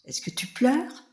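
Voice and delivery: s'étonner de voir le rat pleurer; surpris. A narrator's reading. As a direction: question aiguë et étonnée. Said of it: "demande Éléphanto surpris". (Éléphanto surpris)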